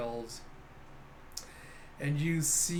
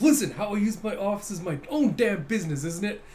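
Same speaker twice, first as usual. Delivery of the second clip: louder, higher indignant protest; freaking out; 5 semitones higher, 5 dB louder than usual. Acted. Captain defending his messy office, mock indignation. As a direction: argue back, acting flustered. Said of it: "he's freaking out".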